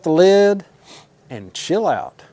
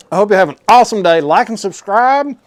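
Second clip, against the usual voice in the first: upbeat outro; 5 semitones higher, 6 dB louder than usual. Acted. Upbeat channel sign-off.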